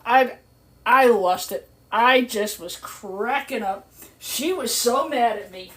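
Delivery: ordinary delivery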